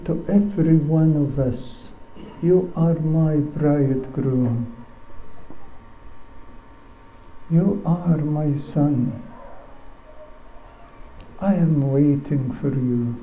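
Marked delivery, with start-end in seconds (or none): 2.4-13.2 gentle loving Lord. Acted. The Lord voiced softly.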